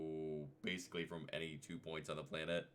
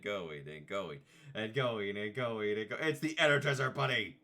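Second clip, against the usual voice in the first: building to a loud, higher commercial announcer; 4 semitones higher, 7 dB louder than usual. Retold or imitated. Mimicking the Energizer commercial, loud ad announcer. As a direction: sell it like a TV battery ad.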